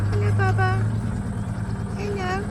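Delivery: high sing-song calling; high cheerful come-come call to the cows; cooing to cows. Real, not acted. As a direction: coax the herd in a high pet voice. Greeting calf and cows.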